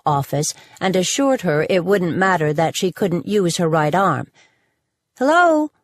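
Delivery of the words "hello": a raised, higher hello; hello called out, pitched up; calling out. A narrator's reading. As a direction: call into an empty office to see who answers. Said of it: "she called out".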